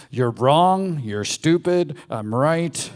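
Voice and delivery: mildly acted, forceful condemnation; harsh judge. The inner judge condemning you, harsh. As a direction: lay down a loud verdict. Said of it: "The judge".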